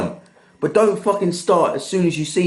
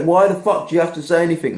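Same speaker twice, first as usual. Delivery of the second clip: normal talking voice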